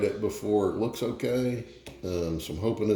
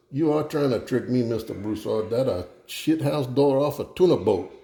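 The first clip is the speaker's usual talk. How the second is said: higher-pitched Cajun punchline; Justin Wilson; 5 semitones higher than usual. Acted. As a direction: land the joke in a Cajun accent. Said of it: "do the Justin Wilson thing and tell a joke".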